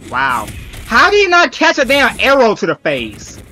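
normal talking voice

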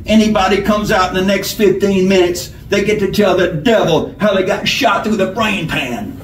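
bossy outlaw giving orders; bullying robber. A narrator's reading. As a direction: announce the hold-up like you own the room. Robber's parting threat.

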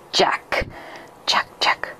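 unvoiced whisper of the name; ghostly whisper. Ghost in the fog answers the hunter, whispering. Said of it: "a voice whispered back".

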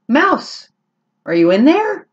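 high, eager little kitten voice; curious kitten calling into a can; kitten Max calling. Acted. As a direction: peer into the can and call out brightly. Max calls into tin can.

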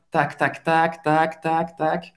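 evenly repeated tac-tac; busy tidying patter; imitating objects' noise. Shown as a demonstration. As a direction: tick off items like objects being set down. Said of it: "vou simular o barulho dos objetos".

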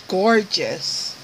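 ordinary talk, nothing marked

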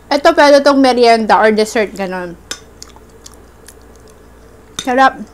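speaking with food in mouth; eating between words; talking while chewing. Real, not acted. Seen 0: Spoon in hand, mouth moves as if chewing between words.

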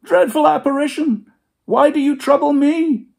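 very high, loud panicked plea; terrified Scrooge. Acted. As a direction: plead in terror.

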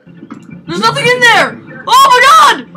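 high piercing scream of fright; terrified screaming. Real, not acted. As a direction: shriek like something's right behind you. Chased by monster in game.

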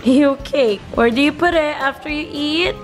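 ordinary delivery

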